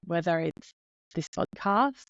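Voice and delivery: ordinary talk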